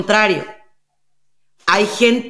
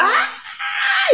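put-on sudden high shrieking child's scream; imitated tantrum scream; 3 dB quieter than usual. Imitating a toddler's tantrum, a high piercing scream. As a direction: burst into a bratty toddler's shriek. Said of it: "y se ponga así".

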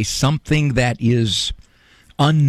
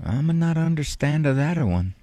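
put-on soft, baffled old Italian grandpa; a hint of an Italian grandfather giving up on understanding; puzzled Italian grandfather; 4 dB quieter than usual. Papo shrugs off his grandson's job, lightly Italian accent. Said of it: "in his broken Italian accent".

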